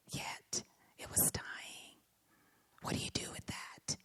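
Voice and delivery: barely voiced, letting each phrase hang; hushed admission. Real, not acted. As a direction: drop to a whisper for the painful truth. Grave admission of a dying commitment.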